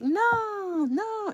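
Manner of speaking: high, loud scolding no; a parent stopping a toddler; mock scolding parent. Parents scolding the toddler, high and loud. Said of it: "we be like".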